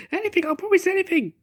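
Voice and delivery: high, desperate plea; pleading. Acted. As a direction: beg high and eager. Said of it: "pleaded the dragon".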